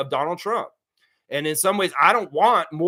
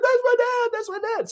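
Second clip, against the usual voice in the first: very high, tearful imitation of a proud son; mock crying. Streamer imitating the governor's son crying at the speech, mock-tearful squeal. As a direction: mimic a son overcome, voice pitched way up. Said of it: "And he's all crying".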